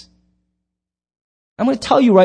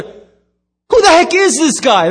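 much higher, louder, slow astonished cry; amazed disciples; 13 semitones higher, 7 dB louder, slower than usual. Acted. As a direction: disciples gasping in disbelief. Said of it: "That's the [name] version".

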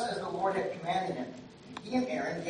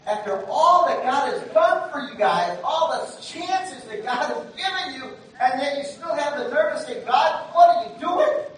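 Moses fed up; 5 semitones higher, 6 dB louder than usual. Retold or imitated. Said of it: "I can just kind of feel Moses like".

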